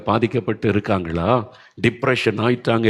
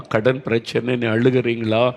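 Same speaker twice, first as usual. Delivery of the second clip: usual speaking voice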